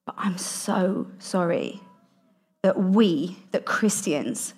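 near-whispered, slow apology; retold heartfelt apology. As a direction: apologise softly and slowly, giving each phrase room. Retold tearful apology to the speaker.

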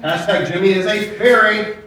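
usual speaking voice

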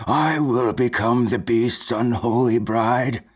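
slow, flat, trance-like offer; flat monotone. A narrator's reading. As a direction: speak it like a sleepwalker. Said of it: "in a flat, monotone voice".

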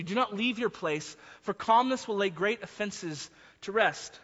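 usual speaking voice

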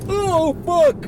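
high, loud startled cry; startled shout. Real, not acted. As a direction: yelp in surprise. Reacting to the scary drop.

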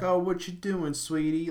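put-on high, sing-song Southern mom accent. Mom on the phone to her daughter, high Southern accent. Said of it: "the mom on the phone is all like".

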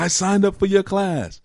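high, whiny mock student protest; high mocking whine of a student. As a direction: squeak it like an indignant student. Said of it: "that's a famous one I hear all the time".

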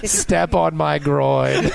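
very slow, hammed-up command; mock seductive role. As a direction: stretch every word for the joke. Joking in a put-on character voice.

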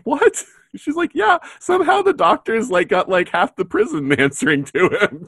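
high mock astonishment; lightly hammed-up high-pitched surprise; high surprised quote. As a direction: squeak it in disbelief. Barbara's friends react, high voices.

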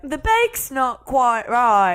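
high, drawn-out British judge imitation; mock British accent. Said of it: "just somebody be like".

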